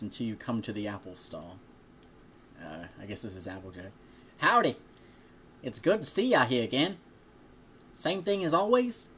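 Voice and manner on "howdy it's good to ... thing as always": attempted southern accent. Reading to a listener, farm girl greeting a regular in a botched Southern accent. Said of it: "Can't do a southern accent".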